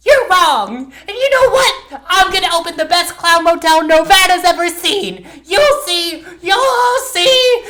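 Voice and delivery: put-on very high, loud drunken defiance; town drunk. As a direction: slur out a drunk brother's vow. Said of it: "decided to reenact this moment".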